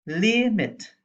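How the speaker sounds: limit with a high, strong LIM; stress on first syllable. Shown as a demonstration. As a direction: punch the start of "limit". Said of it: "we stress on the first syllable".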